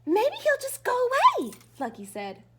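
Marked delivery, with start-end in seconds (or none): 0.1-1.5 lightly voiced eager dog, much higher. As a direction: chirp it like a hopeful puppy. Lucky the dog voiced high.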